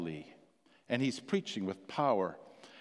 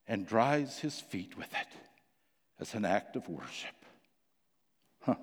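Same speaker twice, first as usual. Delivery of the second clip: hushed intimate preaching; 3 semitones lower than usual. Acted.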